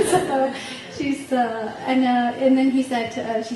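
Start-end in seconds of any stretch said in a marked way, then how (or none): 0.1-0.5 high laughing burst, louder; real laugh. Real, not acted. Laughs at son comforting parent.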